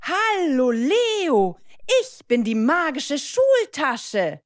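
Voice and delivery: high, loud, grand greeting; magic talking schoolbag. Acted. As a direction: introduce yourself as a lively magical object. (magische Schultasche, hoch und laut)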